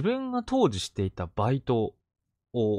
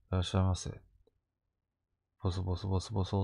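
soft, fast, barely audible mumble; gloomy new hire mumbling a greeting to customers; mumbling quietly; 5 semitones lower, 8 dB quieter, faster than usual. Retold or imitated. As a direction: mumble the greeting under your breath. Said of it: "て小声で言って".

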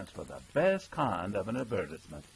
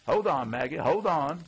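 raised, pleading and hurried; louder, higher urgent begging; 7 semitones higher, 6 dB louder than usual. A narrator's reading. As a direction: plead with her to calm down. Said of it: "he begs".

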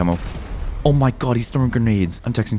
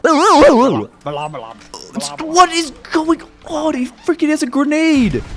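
high-pitched alarmed cry; panicked shriek; 4 dB louder than usual. Acted. Player spotting a grenade, high panicked shriek.

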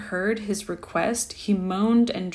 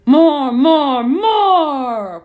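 loud, higher bellowing ghost voice; ghost cry; 6 semitones higher, 10 dB louder, much slower than usual. A narrator's reading. Ghost demanding Dan cook more, loud and high. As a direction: hurl each word out hungrily. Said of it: "cried the ghost".

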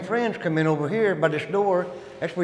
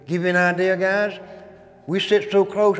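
usual speaking voice

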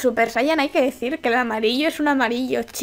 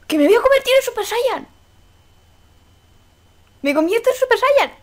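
super excited; 9 semitones higher than usual. Real, not acted. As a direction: burst out thrilled, way up high. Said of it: "estoy súper emocionada".

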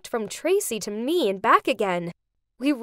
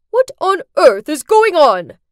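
loud, higher-pitched angry demand; furious yell; 4 semitones higher, 11 dB louder than usual. A narrator's reading. Furious dad interrupts the wedding, yelled demand. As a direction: explode in front of the whole crowd. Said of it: "yelled in front of everyone".

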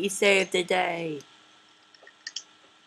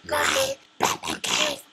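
whispered. Acted. Defending his cave.

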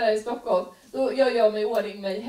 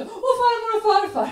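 put-on much higher, louder kid voice; child asking; 10 semitones higher, 6 dB louder than usual. Kid quizzing mom about love, high and loud. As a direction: pester like a little kid.

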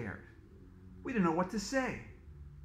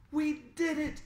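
high excited cheer; excited shout; 10 semitones higher than usual. A narrator's reading. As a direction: cheer the win. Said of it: "Emily shouted excitedly".

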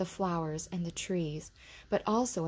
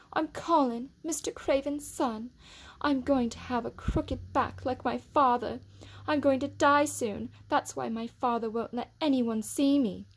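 put-on high, self-pitying boy voice; sickly boy; 7 semitones higher, 3 dB louder than usual.

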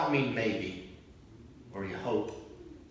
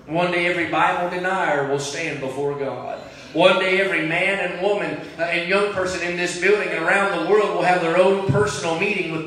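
louder and louder repeated warning; preacher warning the congregation of judgment; building preaching; 3 semitones higher, 5 dB louder than usual. Acted. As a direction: press it home, building each line.

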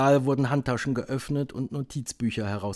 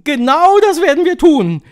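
high, very loud witch screech; shrieked, high-pitched assent; shrieking; 14 dB louder than usual. A narrator's reading. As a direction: screech agreement like an eager coven. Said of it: "kreischten die Hexen".

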